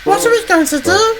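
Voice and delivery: very high female character voice; worried question in a high, girlish voice; wondering Emily. A narrator's reading. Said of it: "she wondered".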